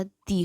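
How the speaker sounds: "दी" with a long ee vowel; THE as thee. Shown as a demonstration. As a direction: say THE as "दी", rhyming with see. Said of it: "टीएचई का उच्चारण". ("दी" में लंबी ई की आवाज़)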